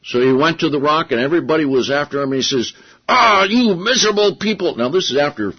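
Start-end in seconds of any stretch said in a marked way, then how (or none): 3.1-4.8 higher, louder, fed-up Moses; exasperated Moses. Retold or imitated. Moses scolding the complaining people at the rock, exasperated and loud. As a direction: spit it out at the grumbling crowd. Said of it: "he was so angry".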